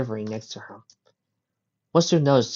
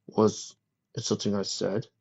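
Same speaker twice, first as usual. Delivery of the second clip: softly apologetic question; 3 semitones lower, 5 dB quieter than usual. A narrator's reading. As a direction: ask gently, a touch sorry. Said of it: "Lester asked apologetically".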